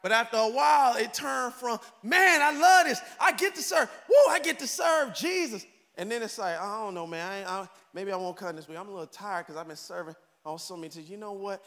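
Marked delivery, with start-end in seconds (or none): 2.0-5.6 higher and louder, gushing; mock excited volunteer. Pastor imitating an eager server, gushing loud. 6.6-10.1 softer and lower, worn-out excuse; mock weary volunteer. Pastor imitating a burnt-out server, weary.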